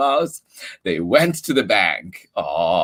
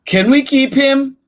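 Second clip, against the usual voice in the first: pleading ask; 7 dB louder than usual. Acted. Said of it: "asked Charlie".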